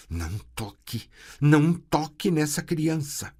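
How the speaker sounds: urgent plea; desperate shout. A narrator's reading. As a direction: shout to protect the child. Sorceress pleading loud.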